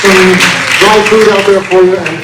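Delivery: loud, slow, showy announcement; announcer voice. Acted. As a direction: sell the snacks like a host. Hammed-up refreshment announcement.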